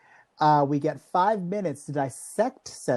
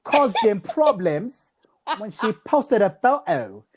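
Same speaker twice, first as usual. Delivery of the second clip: hammed-up British-Jamaican accent for comic news; mock British-Jamaican accent; 7 semitones higher than usual. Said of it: "I gotta get British, British slash Jamaican here".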